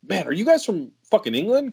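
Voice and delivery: high-pitched incredulous question; astonished quote; retold surprise. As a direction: ask it with mock disbelief, pitch jumping up.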